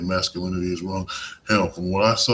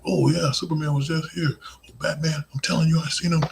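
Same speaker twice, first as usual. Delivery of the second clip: bragging sidekick; 8 semitones higher, 3 dB louder than usual. Retold or imitated. Imitating hero's sidekick bragging.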